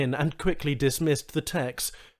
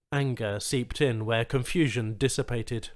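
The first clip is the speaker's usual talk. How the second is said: usual speaking voice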